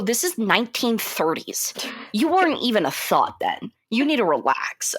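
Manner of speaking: usual speaking voice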